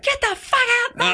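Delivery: very high squealed falsetto; mock girl scream. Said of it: "whatever girls scream".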